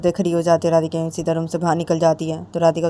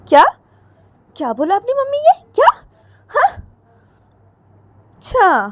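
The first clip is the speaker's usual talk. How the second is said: incredulous daughter; 10 semitones higher, 5 dB louder than usual. Acted. Radhika incredulous at mother.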